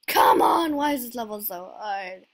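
real gamer frustration; frustrated outburst. Real, not acted. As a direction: burst out at the game. Stuck on a hard level.

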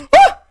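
short, high loud yelp; startled scream. Real, not acted. Player caught by the game, startled scream. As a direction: yelp out suddenly.

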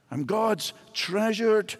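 ordinary talk, nothing marked

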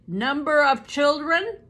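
loud, high, curt snap; bored interrogator snapping at Betsy; snapped. A narrator's reading. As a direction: snap the question impatiently. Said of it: "he snapped".

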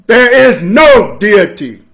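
loud, high and slow; thundering divine proclamation; hollering. Pretend. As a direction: holler it down at them. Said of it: "is hollering at you".

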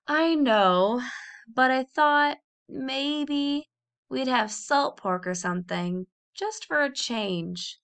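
slow, drawn-out wistful sigh; reluctant, lingering hope in a child's voice. A narrator's reading. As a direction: stretch out the words hesitantly, with a sigh. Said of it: "Warren sighed".

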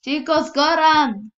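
yelling at teammates to run; urgent loud warning call; shouting. Real, not acted. Teammates fleeing the killer.

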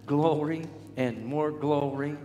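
normal talking voice

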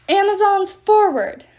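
very loud, high charge order; queen bellowing the charge; battle command. A narrator's reading. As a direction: send the army forward with a roar. Queen commands her army charge.

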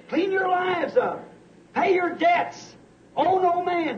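high, slow, each command spaced out; slow pleading commands. Real, not acted. Preacher urging his congregation to get ready, slow high commands. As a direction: drive home each command slowly.

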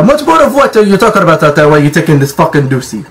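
ordinary talk

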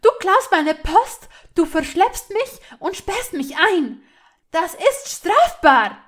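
high, loud list of accusations; agitated shouting. A narrator's reading. Hermine accusing Malfoy of locking her up, agitated shouting. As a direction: hurl each charge at him indignantly. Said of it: "rief sie aufgebracht".

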